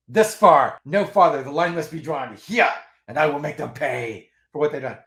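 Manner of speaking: a grand starship captain drawing the line, hammed up; bad Picard impression. Quoting Picard against the Borg, bad hammed-up captain voice. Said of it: "that's my bad Picard impression".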